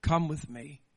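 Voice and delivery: a hushed, loving call; lover's call. Retold or imitated. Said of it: "heard the cry of your lover say".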